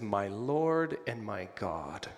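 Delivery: slow, low and reverent; a long journey's end in one breath; awed confession. Shown as a demonstration. Preacher voicing Thomas confessing to Jesus, slow and reverent. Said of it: "I want you to hear that in light of".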